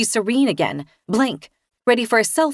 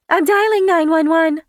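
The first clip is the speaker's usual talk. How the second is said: muffled, raised voice through a door; 8 semitones higher, 5 dB louder than usual. A narrator's reading. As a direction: call through a closed door. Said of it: "comes Kirsten's muffled voice".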